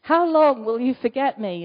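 a loud, full-voiced cry to God; loud pleading question; said loudly. Shown as a demonstration. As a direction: call it out loud like a lament. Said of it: "You are allowed to say it loudly".

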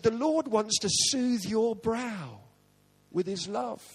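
slow, hushed mock-tender murmur; mock soothing lady. Lady stroking his head, slow mock-tender soothing. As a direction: soothe it out slowly like a healer.

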